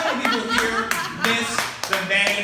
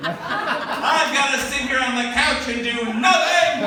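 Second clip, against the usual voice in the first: high, loud mock panic about covid; 10 semitones higher, 6 dB louder than usual. Husband to wife, high loud mock panic. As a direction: ham up a fake emergency to dodge the beach. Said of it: "And I'd be like".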